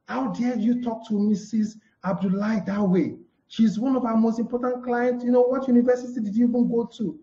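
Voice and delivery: high, scolding reprimand of a junior; angry boss scolding. Shown as a demonstration. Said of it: "Do you just go to Chidi and say".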